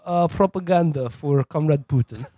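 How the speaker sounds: mock thick Russian accent; hammed-up Russian handler voice. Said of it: "had a thick Russian accent".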